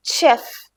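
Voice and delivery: ഷെഫ് with a sh sound, "shef"; German pronunciation. Shown as a demonstration. Teacher shows learners German ഷെഫ്. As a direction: model ഷെഫ് starting with sh. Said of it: "ഷേ sound ആണ് വരുന്നത്".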